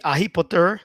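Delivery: Harry Potter without the H, as "ahi poter"; wrong pronunciation. Shown as a demonstration. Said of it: "people won't understand".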